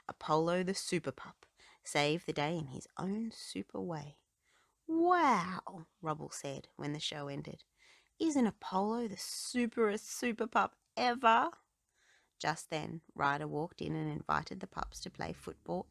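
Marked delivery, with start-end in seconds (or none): 4.9-5.9 awed pup. A narrator's reading. Rubble after the show. 8.2-11.5 excited pup voice. A narrator's reading. Rubble admiring Apollo.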